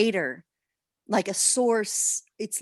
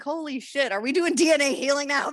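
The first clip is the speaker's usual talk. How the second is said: laughing surprise; 6 semitones higher than usual. Retold or imitated. Sudden realization, laughing.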